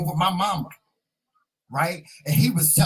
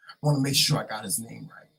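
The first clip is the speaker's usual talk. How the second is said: ordinary talk, nothing marked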